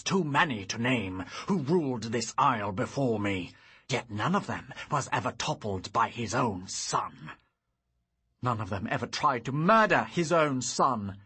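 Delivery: loud, bitter tirade; angry accusation. Acted. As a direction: rage at the son who betrayed him. Father's enraged accusation.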